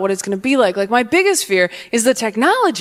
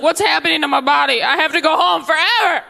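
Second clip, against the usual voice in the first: put-on panicked boy; 5 semitones higher than usual. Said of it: "Just like".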